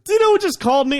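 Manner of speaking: very high, loud excited announcement; squealing, hammed-up amazement; retold thrilled dad. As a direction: imitate a dad bursting with big news. Excited dad announces celebrity call.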